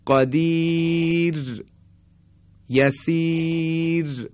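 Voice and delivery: long drawn Quranic vowels; slow recitation. Shown as a demonstration. Teacher recites examples of the thin ر, very slow. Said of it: "مانند".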